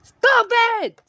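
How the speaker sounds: very high, loud scream; piercing, anguished yell. A narrator's reading. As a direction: scream it out in desperation. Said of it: "crying and screaming and say".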